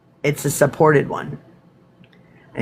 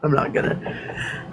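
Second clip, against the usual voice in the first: soft and slow, almost whispered; quiet; 7 dB quieter, slower than usual. Real, not acted. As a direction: hold back the loud burp joke. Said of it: "I should do it real loud for y'all".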